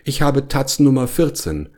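high, lightly voiced bear; a mild high-pitched complaint; complaining bear. A narrator's reading. Bear explains his paw won't fit.

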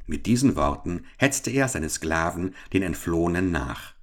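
usual speaking voice

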